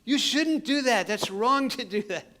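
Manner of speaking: high, loud, slow scold. Retold or imitated. Missionary scolding the thief for stealing his phone. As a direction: draw out the reproach like a stern parent. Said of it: "I'm like".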